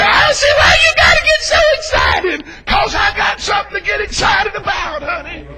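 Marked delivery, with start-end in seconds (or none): 0.0-2.4 high, loud mimicry of a critic; mock complainer.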